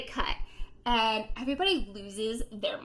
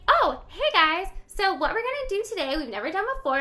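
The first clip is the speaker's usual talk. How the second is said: cheery host greeting; 7 semitones higher, 6 dB louder than usual. Retold or imitated. Elizabeth greets her first live studio audience, high and bright. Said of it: "she's like".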